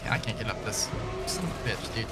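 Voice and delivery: normal talking voice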